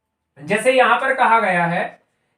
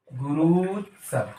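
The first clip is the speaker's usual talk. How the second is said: गुरुत्सव a little lower, slow and falling; settled repeat; 3 semitones lower, 4 dB quieter, slower than usual. Shown as a demonstration. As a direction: confirm गुरुत्सव, dropping to a close. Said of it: "teacher repeats answer". (गुरुत्सव को नीचे उतरते स्वर में पक्का करें)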